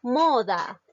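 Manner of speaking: "Moda" with a strong, drawn-out mo, falling on da; stress on MO. Shown as a demonstration. Teacher shows a grave word, stress on MO. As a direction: lean hard on the mo in "Moda". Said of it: "su mayor fuerza de voz recae en la sílaba mo".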